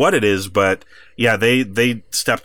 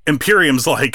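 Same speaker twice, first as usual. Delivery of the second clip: ordinary delivery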